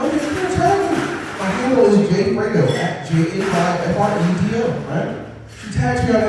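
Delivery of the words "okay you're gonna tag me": much higher, breezy reply; high amused okay to the mom; high mock agreement. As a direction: toss it off high and light. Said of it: "I'm like".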